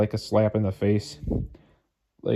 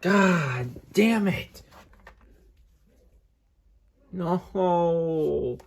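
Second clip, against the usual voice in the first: high, slow wail of real despair; anguished frustration; 7 semitones higher, much slower than usual. Real, not acted. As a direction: lament the damage, dragging out each word. Realises the damage is final.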